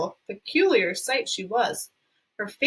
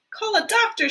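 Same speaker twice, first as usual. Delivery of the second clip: high, urgent shout; 11 semitones higher, 4 dB louder than usual. Acted. A father shouting for a doctor, high and urgent. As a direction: yell for help. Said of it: "shouted Mister Beauregard".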